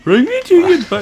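high, loud mock Russian accent; laughing through a Russian accent. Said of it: "why am I speaking like a Russian now".

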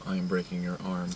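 low, hushed action-hero threat; Steven Seagal. Retold or imitated. Acting out the star fighting guys, low quiet impression. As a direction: imitate a movie fighter, very soft and low. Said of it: "he'll look like this".

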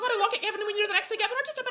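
very high, squawky complaining voice; tipsy high-pitched nagging caricature; pterodactyl woman. Retold or imitated. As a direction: whine like a screeching pterodactyl. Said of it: "you all know a pterodactyl".

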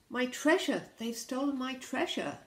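high, loud wail of outrage; shrieked alarm. Acted. Robbed pirate raising the alarm, shrieked. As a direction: cry out over a theft. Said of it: "shrieked a pirate".

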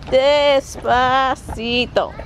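very slow, stretched word; drawn out. Real, not acted. Owner telling her puppy to slow down, drawn out.

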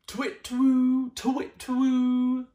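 high, loud hooting owl. Acted. The garden owl at night, high loud hooting.

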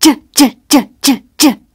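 short rhythmic "J" sounds like a rope slapping; jump rope sound j. Shown as a demonstration. Jenny's jump rope shown as short "j" beats. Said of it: "it sounds like this".

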